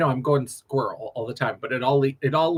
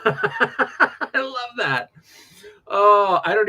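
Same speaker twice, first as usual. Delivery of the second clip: laughing; 7 semitones higher, much slower than usual. Real, not acted. Laughing at a funny name.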